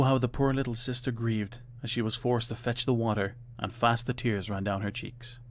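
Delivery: plain reading voice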